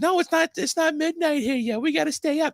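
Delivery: husband mimics wife refusing to go to bed; imitating wife. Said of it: "That's how she sounds".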